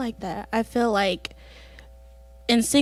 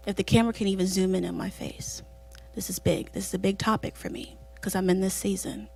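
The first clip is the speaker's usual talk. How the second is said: serious voice; 3 semitones lower, 7 dB quieter than usual. Real, not acted. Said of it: "this is my serious voice".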